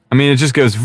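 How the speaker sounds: ordinary talk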